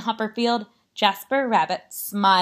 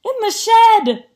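high, loud alarmed scream; 14 semitones higher, 7 dB louder than usual. Acted. Jasper warns Mom of creepy carrots, screaming. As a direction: sound the alarm at full pitch. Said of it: "Jasper screamed".

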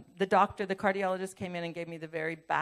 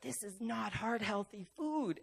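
slow, softer mock disapproval; imitated disgust; 7 dB quieter than usual. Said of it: "just disgusted with me".

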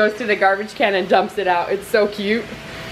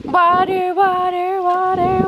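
playful sing-song; 6 semitones higher than usual. Real, not acted. Playing with toddler at water table.

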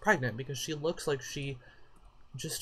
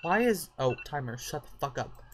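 normal talking voice

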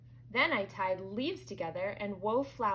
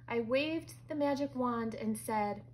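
ordinary delivery

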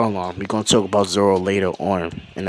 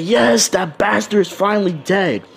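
cheering; 9 semitones higher than usual. Acted. Crew cheers villain's death.

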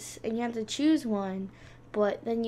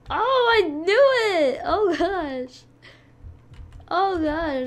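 excited shout; 7 semitones higher, 9 dB louder, slower than usual. Real, not acted. Shocked as teammate dies.

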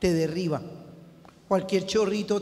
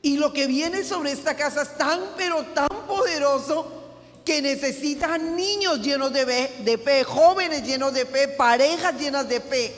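full-force sermon delivery; fervent preaching; 7 semitones higher than usual. Real, not acted. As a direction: preach it home to the congregation, building.

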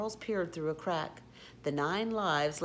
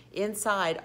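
plain reading voice

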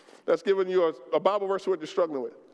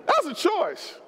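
fiery preaching; 8 semitones higher than usual. Acted. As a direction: drive the point home to the church. Preaching at full force.

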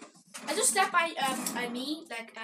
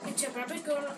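ordinary talk, nothing marked

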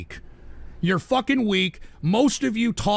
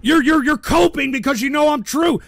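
loud, high, raging yell; furious shouting; 7 semitones higher, 7 dB louder than usual. Real, not acted. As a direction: blow up at the chat, shouting. Furious rant at a chatter.

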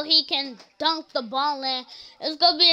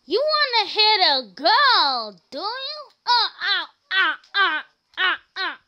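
much higher, squealing yells of ow and oh yeah; hammed-up victory taunt mixed with pain; a kid cartoon fighter crowing over a beaten boy; mock gloating girl; 7 semitones higher than usual. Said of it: "and then she said".

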